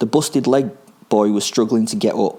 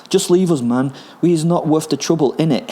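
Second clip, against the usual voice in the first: put-on higher-pitched surrendering boy; pleading boy; 7 semitones higher, 3 dB louder than usual. A narrator's reading. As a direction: plead to be left alone, pitched up. Frightened boy surrendering to attacker.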